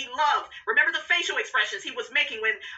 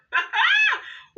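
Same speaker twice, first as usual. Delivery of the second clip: loud, high whooping laugh; big laugh; 3 dB louder than usual. Real, not acted. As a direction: throw your head back and howl with laughter. Cracking up at her own joke.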